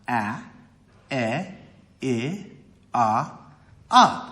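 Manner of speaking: short a, e, i, o, u, each set apart; short vowel sounds. Shown as a demonstration. Teacher demonstrating short vowels, each sounded separately. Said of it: "The short vowels are".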